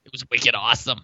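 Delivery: soft, low mock Boston accent; bad Boston accent. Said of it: "bad Boston accents".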